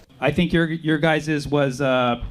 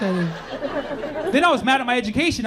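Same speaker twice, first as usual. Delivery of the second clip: ordinary talk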